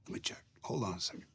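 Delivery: soft, whispered joke to himself; hammed-up hushed aside; hushed mock aside. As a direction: drop to a sly whisper as if covering the phone. Mock aside while checking schedule.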